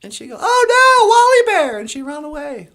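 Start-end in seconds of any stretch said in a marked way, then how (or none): 0.4-1.8 high, loud mock alarm; alarmed cry. Goldilocks waking to find Wally Bear, acted alarm. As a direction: cry out in hammed-up dismay, loudest at the start.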